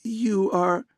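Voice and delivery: amazed man stammering; stammered. A narrator's reading. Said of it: "he stammered".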